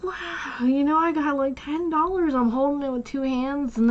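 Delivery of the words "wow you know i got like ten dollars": put-on awed child. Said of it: "And I was just like".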